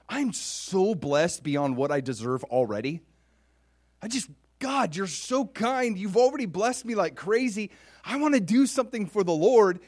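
high, loud, gushing thanks to God; overwhelmed gratitude. Acted. King marveling at God's kindness, gushing gratitude. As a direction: pour it out as if astonished to be so blessed. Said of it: "he was already like".

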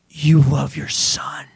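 slow, hushed whisper of wonder; awed whisper. Acted. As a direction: whisper it slowly, awestruck. Acts out Peter's awed realization.

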